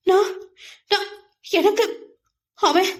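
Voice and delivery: words breaking off, halting; can't get the words out; overcome, stumbling restarts; choked-up stammer. Acted. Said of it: "பேச்சு வராமல் திணறினாள்".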